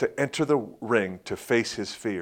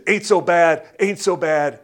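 higher, louder taunt through the punches; taunting boxer; 7 semitones higher, 7 dB louder than usual. Retold or imitated. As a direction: taunt your opponent while taking hits. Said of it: "He says".

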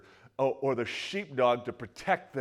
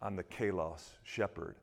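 gentle proclamation; 6 semitones lower, 11 dB quieter than usual. Retold or imitated. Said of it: "Jesus says".

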